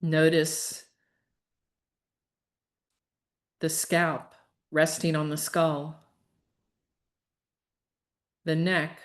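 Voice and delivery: unhurried, even relaxation cues; guided meditation. Real, not acted. Leads a yoga nidra relaxation.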